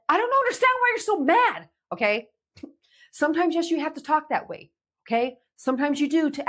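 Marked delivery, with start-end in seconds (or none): 0.1-1.7 much higher, louder sharp accusation; harsh accusing tone. Shown as a demonstration. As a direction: throw it like an irritated parent. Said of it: "Not in a".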